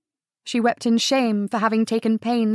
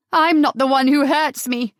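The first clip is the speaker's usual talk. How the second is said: louder, higher anguished outburst; cried out; 4 semitones higher, 5 dB louder than usual. Acted. Sarah crying out at Adam, loud and hurt. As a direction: fling it at him in pain. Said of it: "she cried".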